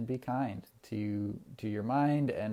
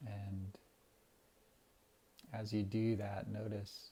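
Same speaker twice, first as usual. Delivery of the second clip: guided meditation. Acted. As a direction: ease the listeners into calm breathing. Leads a breathing meditation.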